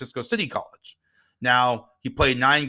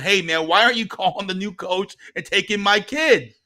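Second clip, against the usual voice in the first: raised, higher-pitched challenge; indignant demand; 7 semitones higher, 4 dB louder than usual. Retold or imitated. Dad calling contacts about his kid, indignant. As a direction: press them in disbelief.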